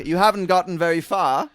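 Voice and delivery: high, loud plummy greeting; mock upper-class butler; posh butler. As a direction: gush to the dog, sniff at the walker.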